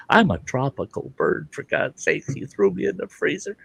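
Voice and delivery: high, softened, complaining bird; freezing parrot. Acted.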